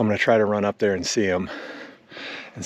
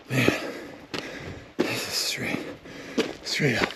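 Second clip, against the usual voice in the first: heavy breathing; 6 dB quieter, slower than usual. Real, not acted. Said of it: "Sorry for the heavy breathing".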